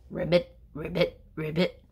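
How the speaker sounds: loud croaking ribbit; frog ribbit. Shown as a demonstration. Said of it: "a sound like this".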